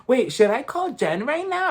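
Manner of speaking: high-pitched imitation of a flustered question; mimicked Whitney. Recapper mimicking a housewife asking the van, high-pitched. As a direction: pitch it up in a mock voice. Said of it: "And then Whitney's like".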